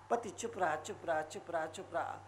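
very soft, low repetition; low, fading quiet refrain; hushed silence. Shown as a demonstration. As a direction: drop to a near-murmur. Silent husband after heated wife.

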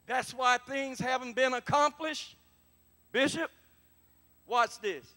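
loud, high, fired-up preaching; full-force preaching. Acted. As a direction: preach it with everything.

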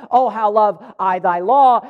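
loud, slowed proclamation; emphatic exclamation. Acted. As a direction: declare it forcefully, stretching "love" and "law". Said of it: "that exclamation mark gives us the emphasis".

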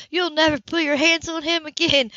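loud, high, furious vow; enraged. A narrator's reading. Grace forbids the mother to touch the boy, enraged. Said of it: "Rage tore through her like a sword".

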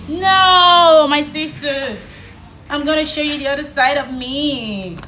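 loud, high, slow warning; loud defiance. Real, not acted. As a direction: draw the line, loud and firm. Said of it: "how she answers back".

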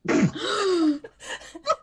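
sharp gasp then laugh; shocked gasp. Real, not acted. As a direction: gasp in mock horror.

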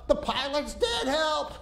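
high mock scream for help. Passenger screaming to the tower, hammed-up panic. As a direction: scream it like he's terrified. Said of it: "Screaming like this".

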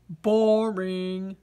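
low, glum potato voice; bored potato. Acted. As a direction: sound like a vegetable with no fun left.

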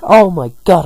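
high, loud gleeful outburst; hammed-up happy cry; happy exclamation. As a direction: burst out delighted, pitch leaping up. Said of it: "and Bidoof's then happy".

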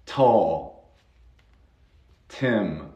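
"Tall" and "Tim" with airy, puffed t; aspirated T. Shown as a demonstration. Teacher extends the rule to T, puffed-t "Tall". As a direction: burst the t of "Tall" and "Tim" with breath. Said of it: "también con la T".

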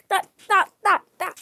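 loud, high frantic stops; panicked stop. Real, not acted. Player yelling at the game in loud panic.

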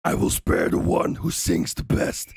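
low, slow, breathy monster rasp; menacing parrot voice. Acted. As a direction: growl it like a sinister bird overlord. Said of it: "I practice this voice every single day".

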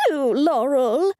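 slow, high furious screech; angry screech. Acted. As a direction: shriek it in fury. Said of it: "she screeched angrily".

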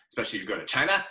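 ordinary talk

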